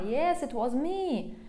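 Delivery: ordinary delivery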